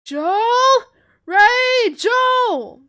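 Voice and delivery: high, very loud calling; shouting names. A narrator's reading. Tess shouting to Joel and Ray, urgent yell. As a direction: yell urgently for both of them. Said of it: "Tess shouts to us".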